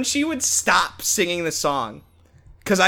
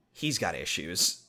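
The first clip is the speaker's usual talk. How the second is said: deadpan mom line; 10 semitones lower, 9 dB quieter, slower than usual. Retold or imitated. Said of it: "she just says".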